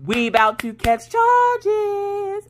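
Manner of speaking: high, slow, drawn-out warning; mock menace. To husband about the rude teen, a slow high mock threat. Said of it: "I'm like".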